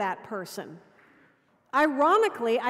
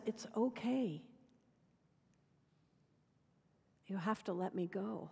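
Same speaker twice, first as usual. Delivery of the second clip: much softer, low, gentle letting go; soft reassurance; 4 semitones lower, 14 dB quieter than usual. A narrator's reading. Dying man comforting the woman he loves, soft and gentle. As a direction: ease her pain with quiet calm.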